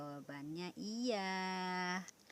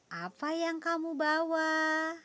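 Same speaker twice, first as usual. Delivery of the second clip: "Apa yang kamu bawa?" pitched high and louder; question intonation; 10 semitones higher, 7 dB louder than usual. Shown as a demonstration. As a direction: ask it as a lively demo question. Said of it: "Intonasi dalam kalimat tanya berbeda".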